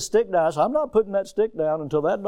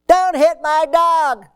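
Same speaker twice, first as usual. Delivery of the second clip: much higher, louder, slow yell of "Don't hit my dog!"; shouting neighbor; 12 semitones higher, 8 dB louder, slower than usual. Retold or imitated. The neighbor shouting at the man with the stick, high loud yell. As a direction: shout it like the dog's owner running over.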